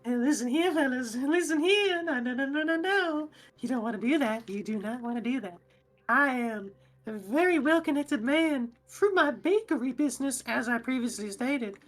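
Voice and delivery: high, smarmy goblin talking his way out; a goblin schmoozing the guards while cuffed; fast-talking goblin. Acted. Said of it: "the goblin is just talking and talking".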